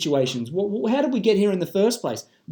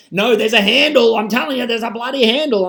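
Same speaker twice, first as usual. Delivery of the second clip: loud, insistent mock quarrel voice; heated, emphatic contradiction; acted argument; 2 semitones higher, 4 dB louder than usual. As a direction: insist loudly like someone in a petty row. Acted argument over the glass.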